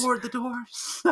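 high, soft, drawn-out squeal; small, slow, high-pitched comic cry; mock panic. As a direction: play along with a hushed falsetto alarm. Joins in the acted panic.

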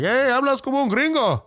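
high, animated Mexican Spanish jeer; real Mexican accent. Retold or imitated. Real Mexican teasing the comic about his Spanish, mocking Mexican accent. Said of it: "if you ask any real Mexican".

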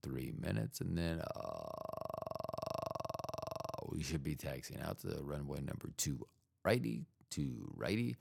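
low, quiet pilot announcement; deep captain cabin announcement; airline pilot voice. Pretend. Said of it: "I sounded kinda like an airline, uh, pilot".

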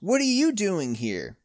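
high, caught-out question; startled. A narrator's reading. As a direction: blurt it in alarm. Caught by the teacher.

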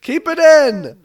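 booming, slow intro announcement; loud announcer. Acted. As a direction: boom out the title. Loud bit from the intro outtake.

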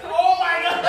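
loud, high panicked "oh my God"; panicked inner cry. Retold or imitated. Realizing she'll be fired.

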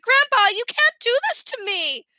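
high-pitched dramatic plea; crying out in protest. A narrator's reading. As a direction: protest as an outraged child would. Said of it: "Sheila cried".

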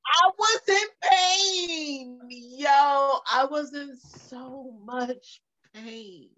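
milk the pain for laughs; agonised mock wail. Dramatising how much it hurt.

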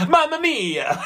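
loud mock Italian, leaping high on the exclamation. Teasing co-host's claim to pass as Italian, mock Italian. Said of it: "pull off being Italian".

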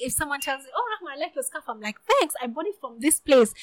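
ordinary talk, nothing marked

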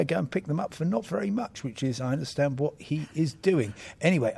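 ordinary delivery

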